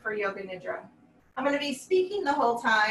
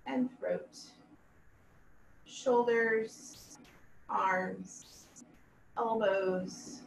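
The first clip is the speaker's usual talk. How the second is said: slow, measured yoga nidra voice with long pauses; guided meditation; 2 semitones lower, 7 dB quieter, slower than usual. Acted. Yoga teacher leading students through a body scan, calm guided meditation. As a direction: guide them calmly, letting each body part settle.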